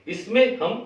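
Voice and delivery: ordinary talk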